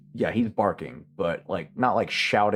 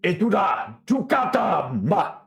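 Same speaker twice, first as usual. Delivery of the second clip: high-pitched shouted orders; shouting; 10 semitones higher than usual. Acted. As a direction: call out commands to the troops. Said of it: "begins shouting".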